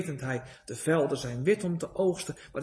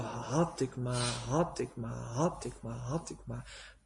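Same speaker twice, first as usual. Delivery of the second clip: eternal regret; 6 semitones lower, 7 dB quieter than usual. Acted. Lost soul's eternal regret.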